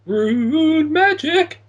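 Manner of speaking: high, loud, slow drawn-out cry; excited shout. Real, not acted. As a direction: belt it out with glee. Excited pick of answer.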